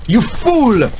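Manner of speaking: high, raging scream at a distant brother; furious scream. A narrator's reading. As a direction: scream it in helpless fury. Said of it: "the king screamed at his brother".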